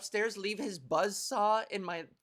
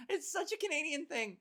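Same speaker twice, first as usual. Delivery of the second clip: ordinary talk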